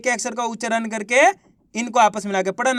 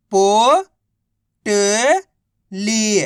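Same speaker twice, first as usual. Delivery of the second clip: drawn out very slowly, each syllable of पोटली held apart; slow phonics spelling-out; much slower than usual. Shown as a demonstration. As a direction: stretch पोटली into separate slow syllables for a child. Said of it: "तो मिलके बन जाएगा".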